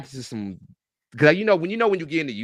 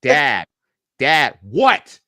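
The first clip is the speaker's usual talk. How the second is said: a son's insistent calls of Dad, rising louder; kid calling his dad; 7 dB louder, much slower than usual. Retold or imitated. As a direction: call out for your father like a nagging kid. Son calling dad at home.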